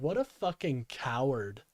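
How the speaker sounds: ordinary talk, nothing marked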